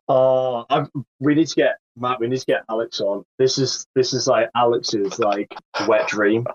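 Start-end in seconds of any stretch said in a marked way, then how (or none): none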